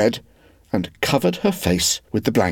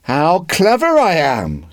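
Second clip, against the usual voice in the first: boastful glee; 7 semitones higher, 4 dB louder, slower than usual. Acted. Peter boasts happily.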